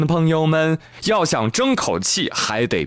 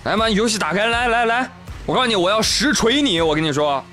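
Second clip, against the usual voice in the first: high, mocking cheater voice; cocky gamer; 10 semitones higher than usual. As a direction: sneer at a busybody, pitched way up. Cheater scolds Peng mockingly.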